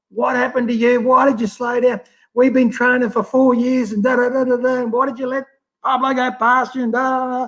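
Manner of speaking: high-pitched mock scolding of a swimmer; berating coach. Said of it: "Laurie is absolutely berating me".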